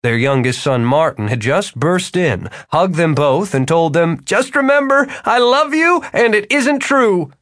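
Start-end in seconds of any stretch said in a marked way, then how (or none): 4.2-7.3 put-on high, earnest young man; urgent young man. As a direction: rush a heartfelt goodbye. Martin to his parents.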